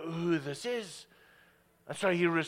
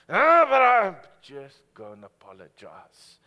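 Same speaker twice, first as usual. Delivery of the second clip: loud scoffing brush-off; dismissive brush-off; 15 dB louder than usual. Retold or imitated. A stubborn believer loudly scoffs at the Holy Spirit. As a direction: wave it away with a loud scoff. Dismissive reply to God's nudge.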